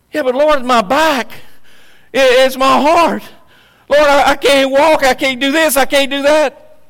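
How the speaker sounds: complaining to God. Retold or imitated. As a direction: whine your list of troubles to the Lord.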